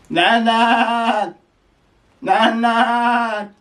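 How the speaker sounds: high bleating call of a name; goat calling. Pretend. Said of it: "That's how your goat gonna call you".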